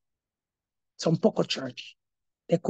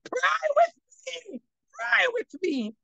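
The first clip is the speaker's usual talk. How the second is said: high, slow, crying plea to pray; begging in panic; desperate plea; 4 dB quieter, slower than usual. Retold or imitated. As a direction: cry out for help, pleading. Begging neighbor in the night.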